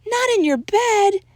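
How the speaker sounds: very high doggy exclamation; exclaiming. A narrator's reading. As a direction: exclaim in shocked little doggy voices. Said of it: "exclaimed all the doggies".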